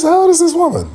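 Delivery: shocked outburst at her age; how old is she, disbelieving; incredulous. Retold or imitated.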